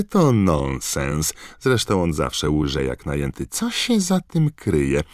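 high, sly, prying tabloid reporter; gossipy journalist voice. Acted. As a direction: drip with nosy glee. Narrator voices gossip journalist Rita.

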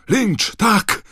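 very high, loud bellow; roar. A narrator's reading. Harry predicts the seeker's crash, roaring. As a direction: roar it out at the top of the voice. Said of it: "ryknął Harry".

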